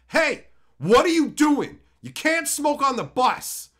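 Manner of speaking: high, loud, slow call-out; indignant yell at a smoker; re-enacted yelling. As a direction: shout a drawn-out public reprimand. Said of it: "do some more yelling".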